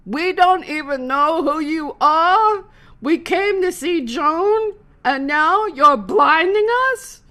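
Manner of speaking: high, loud, slow angry heckle; heckler yelling. Retold or imitated. Heckling man in the crowd, loud angry yell. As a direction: bellow it like an outraged fan. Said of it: "A man near the front yelled out".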